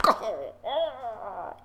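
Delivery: imitating overcome. Imitating his own reaction.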